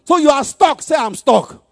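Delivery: preaching at full force. Acted. Preacher pressing husbands, loud. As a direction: press the point to the men.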